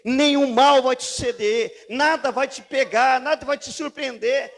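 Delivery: loud, driving pulpit shout; fiery preaching. Acted. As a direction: preach it with full fire. Preaching at full force.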